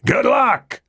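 high, carrying call over the noise; shouted farewell. Acted. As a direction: shout it across to them. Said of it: "King shouted".

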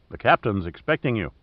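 ordinary delivery

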